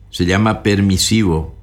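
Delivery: ordinary talk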